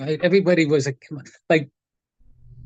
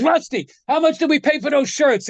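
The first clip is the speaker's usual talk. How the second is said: high-pitched call over to a son; acted-out shout-over, pitched up without more volume; imitated calling out; 8 semitones higher than usual. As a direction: imitate a boss calling across the room. Les calls over to his son.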